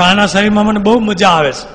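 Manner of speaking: loud, high, stressing બહુ hard; gleeful demon. Acted. Demon telling Maharaj why he stays, loud and high. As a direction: revel in it. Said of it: "તો કે".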